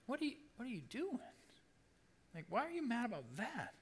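high, softened incredulous squeak; exaggerated incredulous questioning; baffled disbelief. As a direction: ask it in high-pitched bewilderment. Voicing disbelief at Jonah's anger.

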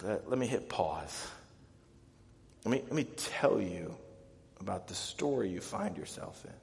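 quiet, unhurried reassurance; calm reassurance. Acted. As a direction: gently step in to comfort the distraught. Pausing to reassure distraught believers.

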